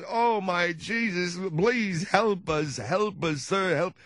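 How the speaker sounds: put-on high, slow, quavering old-man plea; slow, high, desperate old man begging; pleading old man. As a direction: beg like a frail old man in the rain.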